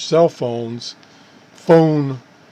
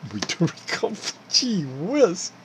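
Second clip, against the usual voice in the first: laughing; 5 semitones higher, 5 dB quieter, much slower than usual. Real, not acted. Laughing at own mistakes.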